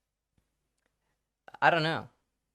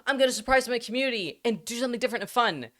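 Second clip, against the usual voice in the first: higher-pitched, eager little announcement; excited announcement; 7 semitones higher than usual. Acted. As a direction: act out cheerful excitement to share a surprise. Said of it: "this is me being like".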